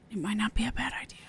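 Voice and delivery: fast unvoiced whisper. Real, not acted. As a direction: whisper it to yourself. Sudden whisper.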